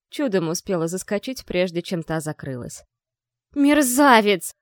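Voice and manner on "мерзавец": громкий медленный яростный крик; выкрикни оскорбление во весь голос; shouting. A narrator's reading. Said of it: "прокричала". (громкий крик)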